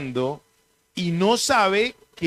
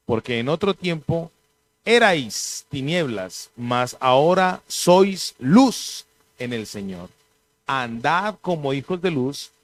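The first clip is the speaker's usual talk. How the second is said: scripture reading; 4 semitones lower than usual. Acted. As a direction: read the verses with reverent weight. Reads scripture aloud.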